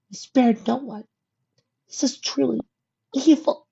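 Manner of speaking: put-on high young pony, near tears; tearful filly voice. A narrator's reading. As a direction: recount the horror, close to crying. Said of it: "eyes watering at the memory".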